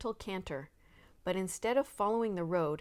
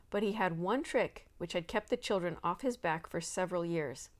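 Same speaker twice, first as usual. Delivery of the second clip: plain reading voice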